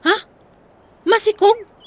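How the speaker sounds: high, loud disbelieving echo; shocked question. Acted. Daughter hearing of the pregnancy, loud shocked echo. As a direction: repeat it in disbelief.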